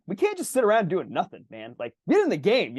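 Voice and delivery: impatient, hammed-up pep talk; yelling at himself. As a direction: raise the pitch and goad a slacking teammate. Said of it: "I'm, like, yelling at my elephant".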